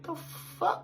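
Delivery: hushed, spooked mock whisper; whispered disbelief. Seeing horns on the guide's face, stunned whisper. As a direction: whisper it as if stunned by what you see. Retold dread at eerie grinning guide.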